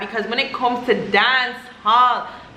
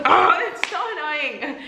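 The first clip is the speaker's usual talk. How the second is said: higher, drawn-out groan of annoyance; exasperated; 5 semitones higher, slower than usual. Real, not acted. Venting over workers who don't care, exasperated. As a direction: stretch it out, fed up. Venting about slow service.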